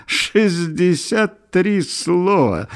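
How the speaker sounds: high, slow, drawn-out marvelling; delighted amazement. A narrator's reading. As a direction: gasp it out in wonder, stretching each word. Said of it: "восхищённо ахнул Николка".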